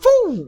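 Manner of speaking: high whoosh as the grandson drops; mock knockout whoosh. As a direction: puff it out high and sudden. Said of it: "he literally went".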